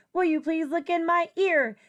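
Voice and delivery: ordinary talk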